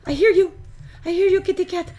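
loud, high call to a pet. Pretend. Player answers the kitty cat they hear, loud high call.

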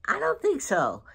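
high, incredulous "I don't think so"; retelling a startled denial to her husband; surprised denial. Retold or imitated. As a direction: push it up in disbelief. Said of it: "I said".

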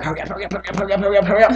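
hushed, frantic repeated muttering; scared urging. Acted. Person secretly peeing outside, nervous hushed urging. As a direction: urge yourself on under your breath. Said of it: "'Cause I was scared".

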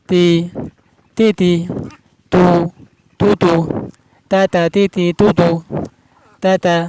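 তা, তি, তু barked out loud and clipped as drill syllables; loud, punchy syllable drill of তাতা, তিতি, তুতু; drilling তা with vowels. Shown as a demonstration. Teacher drilling তা with vowel marks, loud and punchy. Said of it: "হারাকাত দিয়ে".

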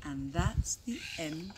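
low, hushed goodbye; very quiet, low-voiced wrap-up; soft sign-off. A narrator's reading. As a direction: close the book gently, almost whispering. Gentle sign-off after the story.

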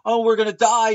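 high, loud hammed-up terror; mock panic. Vlogger mocks passengers who just scream, high mock terror. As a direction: squeal it like a helpless victim. Mocking scared bus passengers.

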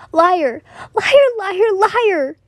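high crying accusation; sobbing shout. Acted. Boy cut her off as she tries leaving, sobbing high shout. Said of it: "he shouted as he sobbed".

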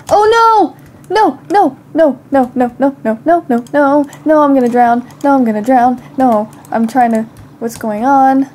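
real panic, rising and loud; panicked cries. Real, not acted. Drowning in game, panicking.

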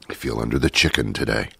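muttered quick joke repeat; quiet aside. Shown as a demonstration. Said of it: "Makes as much sense as under the weather".

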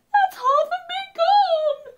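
high, loud panicked wail; panicked cry. A narrator's reading. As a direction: wail in panic as you are eaten. Gingerbread man being eaten.

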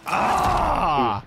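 high, long open "ah" yell; drawn-out cry. Real, not acted. Gamer crying out mid-match, drawn-out yell.

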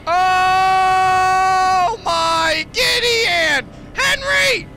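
very high, loud screaming; panicked scream. Real, not acted. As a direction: scream for your pet in terror.

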